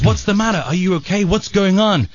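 high, urgent mock concern; alarmed gang leader. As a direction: fire anxious questions at a hurt man. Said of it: "runs up to him and he says".

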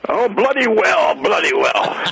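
drawn-out mock-British accent; put-on British accent. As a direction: catch the British accent and ham it up. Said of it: "it's almost infectious".

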